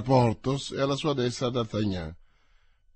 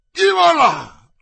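much higher, louder, slow cutthroat's yell; menacing yell; 12 dB louder, slower than usual. Acted. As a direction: yell it at the coach door, threatening. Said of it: "urlò costui". (urlo minaccioso altissimo e lento)

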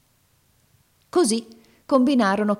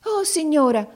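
much higher, louder cry of relief; grateful exclamation; 11 semitones higher, 5 dB louder than usual. Acted. Girl thanks the old woman, relieved and loud. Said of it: "esclamò la ragazza".

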